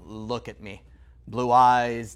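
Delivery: normal talking voice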